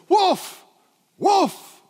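two high, loud barked cries; shouted warning. Shown as a demonstration. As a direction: call out the danger to your teammate. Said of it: "A good church leader says".

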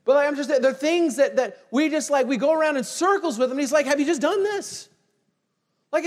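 loud, high preaching push; fired-up preacher calling out his listeners; full-force preaching. Acted. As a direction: drive the point home to the congregation.

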